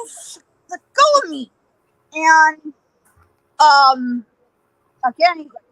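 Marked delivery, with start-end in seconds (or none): 0.1-1.5 high mock-panicked plea; panicked protest. Captive struggling in Ike's grip, high acted panic. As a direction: squirm free with a pitched-up protest.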